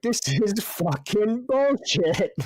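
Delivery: imitating the third-place racer's high, slow complaint; mock angry cyclist. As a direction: ham up a sore loser's outrage.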